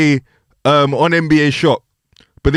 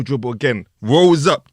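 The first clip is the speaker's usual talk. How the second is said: usual speaking voice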